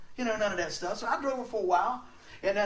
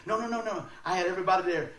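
usual speaking voice

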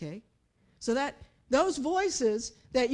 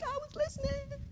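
much higher, softer sing-song; chirpy reply; 13 semitones higher, 8 dB quieter than usual. Pretend. Shopper answering God, high soft pleased reply. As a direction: sound pleased with yourself.

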